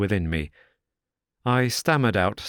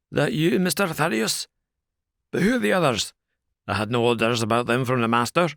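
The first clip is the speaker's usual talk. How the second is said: higher, rough-edged servant's voice; a wary doorman sticking to his orders; the porter McMurdo questioning Thaddeus at the door, rough and wary; gruff porter; 4 semitones higher, faster than usual. A narrator's reading.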